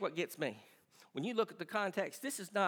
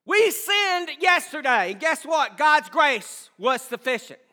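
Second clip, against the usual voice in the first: preaching at full force; 9 semitones higher, 12 dB louder than usual. Acted. Pastor insisting grace is sufficient, building preaching shout. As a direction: hammer it home to the church.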